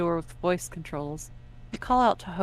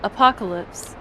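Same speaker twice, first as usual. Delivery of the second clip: much louder, falling; password to the door; 3 semitones higher, 8 dB louder than usual. Acted. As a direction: say it firmly into the door.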